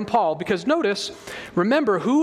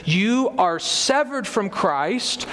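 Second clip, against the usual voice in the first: slow, weighty stress on every word; emphatic; much slower than usual. Retold or imitated. Preacher quoting Paul's warning to the congregation, slow and emphatic. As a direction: let each word land with full weight. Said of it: "he says it as emphatically as he can".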